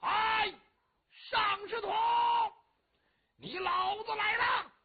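high, long-drawn battle challenge; loud, high taunting call, vowels stretched out; great shout. Acted. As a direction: bellow a challenge across the battlefield. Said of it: "大喊了一声".

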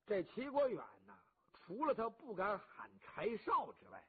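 ordinary delivery

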